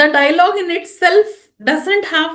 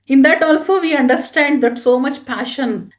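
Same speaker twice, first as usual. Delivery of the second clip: normal talking voice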